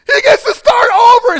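high, very loud joyful wail; hammed-up blubbering with joy; mock bawling. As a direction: cry it out at full volume, overwhelmed. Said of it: "So I'm bawling at this part".

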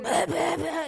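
mock sputter of someone half-drowned. Peter hauled into the boat half-drowned, mock sputtering. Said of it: "you know, sputtering".

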